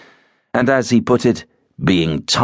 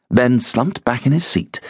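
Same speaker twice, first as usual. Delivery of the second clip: ordinary delivery, nothing marked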